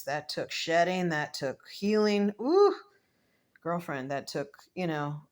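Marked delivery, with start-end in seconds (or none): 2.4-2.7 much higher, louder exclaimed ooh; excited ooh. Real, not acted. Vlogger reacts to her own point with a high excited ooh. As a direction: let out a big excited ooh.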